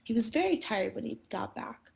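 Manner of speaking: usual speaking voice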